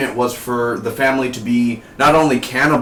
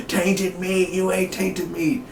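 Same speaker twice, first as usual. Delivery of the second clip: much higher, slow imitated character voice; Walking Dead quote; 8 semitones higher, 3 dB quieter, slower than usual. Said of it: "like Walking Dead, remember?".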